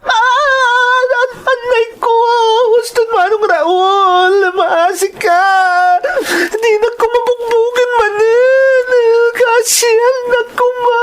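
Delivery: high wailing sobs, begging for mercy; wailing in pain. Acted. Beaten sibling wailing to brother for mercy. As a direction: howl through the tears for it to stop. Crying under beating.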